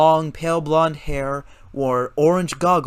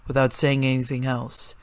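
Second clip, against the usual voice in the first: usual speaking voice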